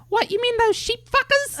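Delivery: very high falsetto Australian woman; incredulous, squeaky mock Aussie accent; Karen Pitts. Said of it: "she was sort of sounding like this".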